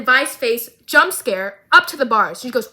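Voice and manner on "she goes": ordinary talk, nothing marked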